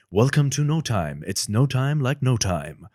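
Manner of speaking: big, loud, slow trailer announcer; booming movie trailer narrator. Shown as a demonstration. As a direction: boom it out like a film trailer. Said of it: "the movie trailer voice".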